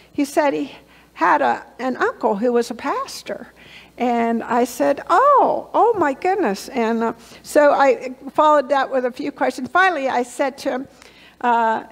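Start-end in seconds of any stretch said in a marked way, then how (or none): 5.1-6.7 an Oh that jumps up in surprise; surprised exclamation. Retold or imitated. Surprised at uncle pastor.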